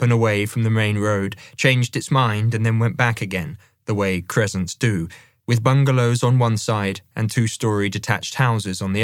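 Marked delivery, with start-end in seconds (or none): none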